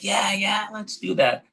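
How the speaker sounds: put-on high, excited little kids' cheer; eager kids. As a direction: squeal it like an eager kindergartner. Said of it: "the kids were like".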